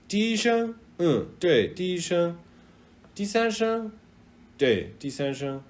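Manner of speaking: high, quick questioning; eager learner. Pretend. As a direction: play learner and native checking tones. Asking a Chinese person tones.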